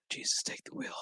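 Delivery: unvoiced exasperated whisper. Real, not acted. Reviewer fed up with the show, whispering in exasperation. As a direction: mutter it like a weary prayer.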